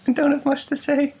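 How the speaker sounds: high, hesitant put-on voice; timid. Host spoofing how he starts podcasts, timid high voice. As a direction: falter as if forced to speak.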